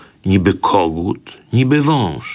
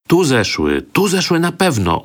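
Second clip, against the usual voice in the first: townsfolk voices; 10 semitones higher than usual. A narrator's reading. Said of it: "ozwały się głosy na górze".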